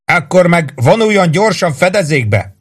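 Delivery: sustained high-pitched call; narrated shout, pitch held up. A narrator's reading. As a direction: yell a report down from above. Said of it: "ordította le Warden".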